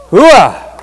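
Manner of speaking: army cheer; loud gung-ho soldier. Real, not acted. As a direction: bark it like a drill sergeant. Military cheer shouted to audience.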